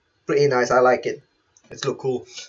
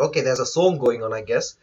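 ordinary delivery

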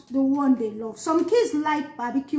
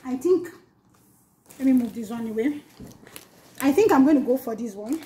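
ordinary talk

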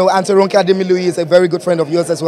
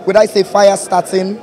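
hyped red carpet host intro; gushing red carpet chatter; 2 semitones higher than usual. Acted. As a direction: announce her like a star arriving.

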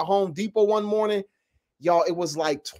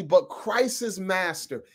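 ordinary talk, nothing marked

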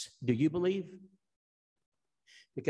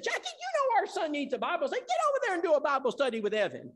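bossy wife; 13 semitones higher, faster than usual. Retold or imitated. Wife Sheila voiced, ordering husband.

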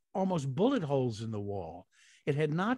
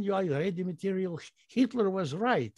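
put-on Russian accent; 6 semitones higher than usual. Russian character voiceover quoted.

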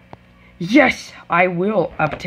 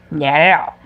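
ordinary talk

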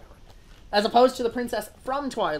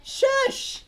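high shush; 5 dB louder than usual. Acted. Hushing the friend's spoiler.

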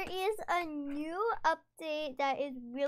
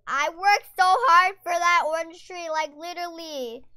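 much higher, louder, half laughing wail; loud frustrated outburst; 4 semitones higher, 13 dB louder than usual. Real, not acted. Gamer yelling about her lost orange tree, loud and exasperated. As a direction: yell it out, exasperated but amused. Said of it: "I'm mad right now".

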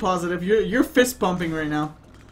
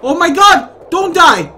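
loud, high alarmed yell; loud mock panic; panicked shout; 8 semitones higher, 11 dB louder than usual. Real, not acted. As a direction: shout to stop the fall. Character about to fall.

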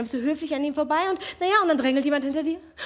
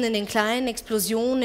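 ordinary talk

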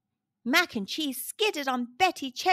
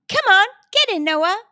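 high, loud urging; urgent calling; 8 semitones higher, 8 dB louder than usual. A narrator's reading. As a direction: call a friend over excitedly. Blue urges Noah into car.

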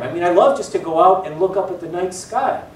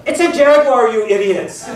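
a retold yell, higher and louder; mock shout; 5 semitones higher, 5 dB louder than usual. Professor yelling the answer at his students, mock shout. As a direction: burst out at the students.

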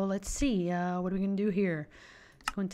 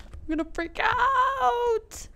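high, slow squeal of excitement; stretched, high-pitched outburst; freaking out; 4 dB louder, slower than usual. Real, not acted. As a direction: wail it out, overcome with excitement.

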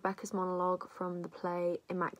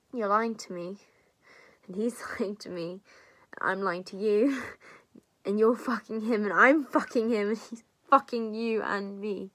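escalating outrage; 3 semitones higher, 7 dB louder than usual. Acted. Confronting her friend over the shared lover, angrily escalating. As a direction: pile up the betrayals, building to a loud peak. Realising everyone is betraying everyone.

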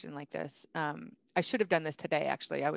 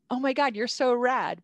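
higher, louder mock-fan gush; gushing flattery; 6 semitones higher, 5 dB louder than usual. Flattering customers so they feel anointed, gushing. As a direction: gush admiringly, playing it up.

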